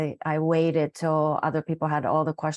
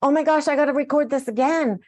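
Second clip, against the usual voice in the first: higher, louder and quicker, gushing; excited exclamation; 7 semitones higher, 4 dB louder, faster than usual. Retold or imitated. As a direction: blurt it out in a rush of delight. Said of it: "And she goes".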